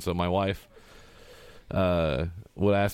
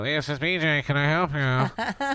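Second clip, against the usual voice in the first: higher-pitched put-on phone voice, drawn out at the end; imitated phone greeting; 7 semitones higher, slower than usual. Coworker picking up the work phone, put-on greeting voice. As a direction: imitate a coworker answering calls. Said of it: "he'll pick up the phone and go".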